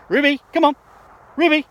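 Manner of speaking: shouting the dog's name, high and loud; calling the dog. Real, not acted. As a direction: call out to a dog across a field.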